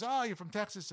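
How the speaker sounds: put-on high-pitched Texas accent. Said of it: "with a Texas accent".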